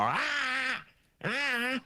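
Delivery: high, hammed-up ahs; imitating Jack Nicholson's warm-up cries; mock war cry. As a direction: yelp like an actor warming up. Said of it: "jumping up and down going".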